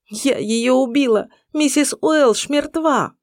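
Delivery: громко, высоко, сквозь всхлипы; прокричи в трубку, рыдая; плач по телефону. A narrator's reading. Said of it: "между всхлипами прокричала в трубку". (крик сквозь рыдания)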